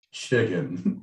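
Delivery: low-pitched confirmation of chicken; confirming. Acted. Repeats the answer lower.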